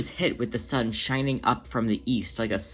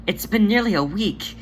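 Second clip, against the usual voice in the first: excited relief; 9 semitones higher, 5 dB louder than usual. Acted. Demeter hears mate is safe.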